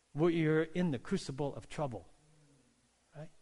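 ordinary talk, nothing marked

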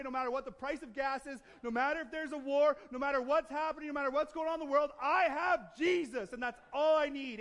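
loud, high, fervent proclamation; rousing preacher, hammering each phrase; full-force preaching. Real, not acted. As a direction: declare it to the whole church with total conviction.

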